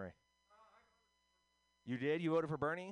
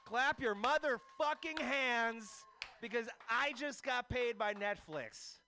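hype shout; 10 semitones higher, 4 dB louder than usual. Acted. Hyping the crowd after song.

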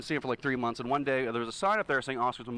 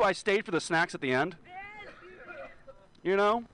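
normal talking voice

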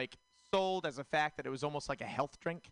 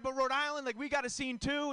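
much higher, hammed-up eager voice; mock eager self; 10 semitones higher than usual. Comic retelling his boast about Boston friends, high mock eager. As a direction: play yourself as an overeager fan. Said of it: "I'm like".

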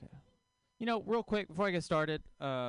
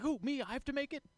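higher, startled mock celebrity chef; mock Guy Fieri; 9 semitones higher than usual. Comic imitating a celebrity chef protesting, higher and startled. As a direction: squeak out a surprised protest. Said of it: "Guy Fieri being like".

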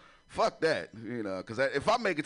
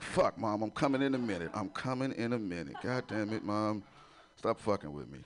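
annoyed son; 8 semitones lower, 5 dB quieter than usual. Retold or imitated. Retold annoyed reply to mom.

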